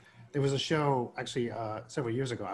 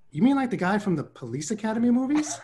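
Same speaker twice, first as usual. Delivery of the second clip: higher, through a laugh; laughing quote; 8 semitones higher, 3 dB louder than usual. Retold or imitated. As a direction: toss the question off while laughing. Retelling his reply to producer.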